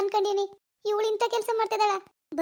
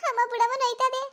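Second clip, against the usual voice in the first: higher, pleading whimper; pleading cry; 5 semitones higher than usual. Acted. Daughter begging her mother to stop hitting, high and pleading.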